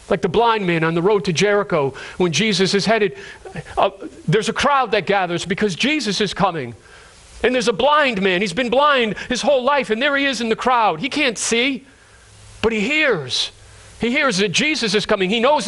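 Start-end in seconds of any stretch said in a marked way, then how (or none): none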